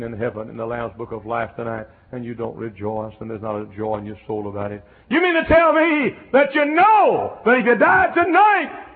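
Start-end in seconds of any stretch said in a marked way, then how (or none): 5.1-8.9 high, loud, slow preacher's cry; full-force preaching. Acted. Preacher crying out to the congregation, loud and drawn out. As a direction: lift it up and hold every word out to the congregation.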